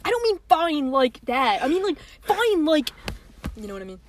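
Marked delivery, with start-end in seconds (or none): 0.0-1.6 put-on drawn-out, slow boyfriend protest; mock rom-com boyfriend, stretching "fine". Friend mocking how co-host explained "fine", rom-com boyfriend voice. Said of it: "You sound like a Not Like Other Girls rom-com boyfriend". 1.6-2.9 higher mock boyfriend, lifting "fine"; earnest boyfriend clarifying, pitched up on "fine"; rom-com boyfriend. Friend mocking co-host's clarification, higher rom-com boyfriend voice. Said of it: "rom-com boyfriend who's like".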